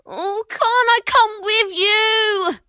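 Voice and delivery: put-on high, slow pleading boy. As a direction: beg like a whiny kid. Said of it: "pleaded Ben".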